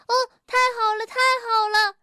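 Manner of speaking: high, happy exclamation; delighted. A narrator's reading. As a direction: exclaim with glee in a high storybook voice. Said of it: "跳跳听了非常的高兴". (高兴地欢呼)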